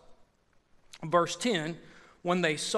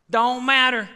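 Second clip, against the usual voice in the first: louder, punched Don't matter; forceful louder repeat; emphatic repeat; 3 semitones higher, 5 dB louder than usual. Acted. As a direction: slam it down the second time. Preacher repeats line, building.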